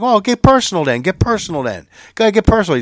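daring him to go on, half acted; defiant dare. T O daring C T in quarrel.

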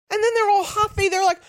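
very high, loud squeal of outrage; exasperated. Real, not acted. Host ranting about huffy officials, exasperated. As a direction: voice shooting up in comic indignation.

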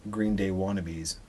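usual speaking voice

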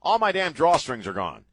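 frustrated outburst; exasperated. Real, not acted. Exasperated repeat of complaint.

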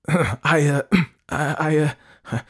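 high, loud false starts with throat-clearing; nervous, choked-up stuttering; tongue-tied stammer. A narrator's reading. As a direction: clear your throat and falter. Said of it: "She cleared her throat".

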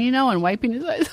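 usual speaking voice

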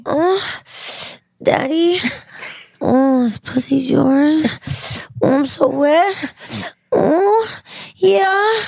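high, breathy fake moans; moaning. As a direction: ham up a sultry moan. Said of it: "Can you do that, "Oh, daddy" thing".

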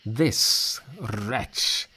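scornful narrated character line; contemptuous. A narrator's reading. Heavenly commander dismissing a captive spirit, contemptuous. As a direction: spit out the words with cold contempt. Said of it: "looking contemptuously at the spirit".

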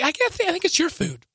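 high, mock-innocent voice; playing innocent. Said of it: "I was like".

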